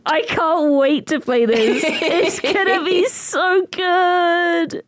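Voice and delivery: drawn-out, high, gushing anticipation; excited. Real, not acted. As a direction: stretch it out, bubbling with excitement. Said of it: "I'm so excited".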